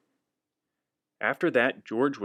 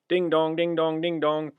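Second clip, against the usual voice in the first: higher sing-song bell call; bell sound; 5 semitones higher than usual. Acted. Reader imitating the fire engine bell, sing-song.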